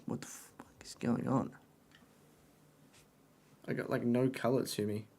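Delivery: hushed, stunned whispered aside. Real, not acted. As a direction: whisper it to yourself in disbelief. Aside about looking pale.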